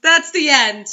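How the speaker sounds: slow, loud outraged echo; loud protest. Real, not acted. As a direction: drag out the protest loudly. Echoes the outraged shout.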